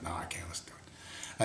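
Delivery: hushed reply; quoting the kid in a whisper. Retold or imitated. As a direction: whisper it back nervously. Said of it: "He's like".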